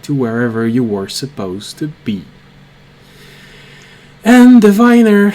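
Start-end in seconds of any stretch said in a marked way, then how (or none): 4.2-5.3 loud, high summons; calling out. Acted. Wizard calls on the guide, loud and high. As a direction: boom the title out to summon them.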